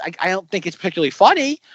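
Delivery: ordinary talk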